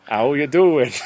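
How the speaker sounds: put-on loud, jovial northern English accent; vaguely northern accent. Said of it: "With me vaguely northern accent".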